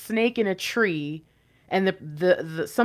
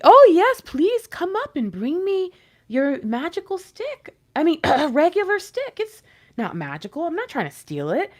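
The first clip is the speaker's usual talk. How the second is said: scheming snake; 8 semitones higher, 7 dB louder than usual. Retold or imitated. Snake luring the helper up the tree, high and oily. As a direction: sweet-talk them into bringing the stick. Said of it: "snake is like".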